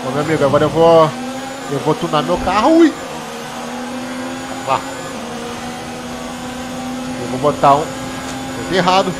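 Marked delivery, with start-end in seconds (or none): none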